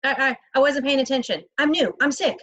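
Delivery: high, halting feigned confusion; nervous stammer. A narrator's reading. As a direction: play dumb and queasy. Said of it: "look dumb enough and queasy".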